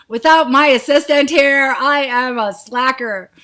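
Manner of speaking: laughing at herself, words riding the laugh; real laughter. Real, not acted. Laughing at being off her game.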